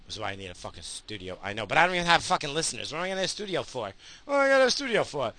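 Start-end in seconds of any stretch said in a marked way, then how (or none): 4.2-5.3 high, fast, mocking sing-song. Shown as a demonstration. Echoing himself about a studio, mocking voice. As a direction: mimic your own question back in a silly voice. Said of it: "repeated in a mock voice".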